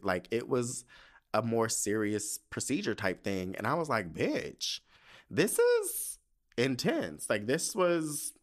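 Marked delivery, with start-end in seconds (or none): 4.1-7.3 slow, high, drawn-out emphasis; dramatic realization. Retold or imitated. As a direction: draw it out, stunned. Retold realization before procedure.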